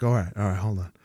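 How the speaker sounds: low, soft, calming reply; speaking calmly. Retold or imitated. Brother steadying his panicking sister in the game, speaking calmly. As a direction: settle her down quietly. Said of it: "you gotta speak calmly".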